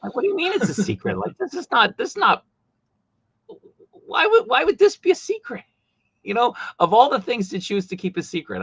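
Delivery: high-pitched, mock-outraged disbelief; comically exasperated, voice shot way up; incredulous protest. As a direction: squeak it out, baffled by the secrecy. Replaying baffled reaction to secrecy.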